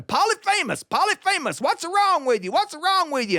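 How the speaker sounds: high-pitched calls across a distance; storyteller's high, acted yell. A narrator's reading. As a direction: holler it high, like giants calling over ridges. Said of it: "And they started yelling".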